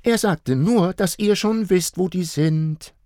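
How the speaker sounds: put-on high, loud child voice. A narrator's reading. Little girl telling parents about gifts, high. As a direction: tell it brightly like a little girl. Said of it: "meinte Marla".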